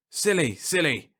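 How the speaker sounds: slow, distant repeated words; echo. Acted. Captain's word echoing back, slow and distant. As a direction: let it ring off like an echo.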